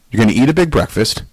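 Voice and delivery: ordinary talk, nothing marked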